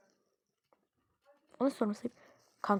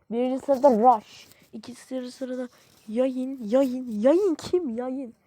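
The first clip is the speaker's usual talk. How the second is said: loud ranking read; 10 dB louder, slower than usual. Real, not acted. Reads scoreboard excitedly.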